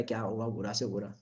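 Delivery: a hint of a hyped-up coach, said mockingly low. A coach's pep line, imitated softly and mockingly. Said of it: "कोई coach बोलता है".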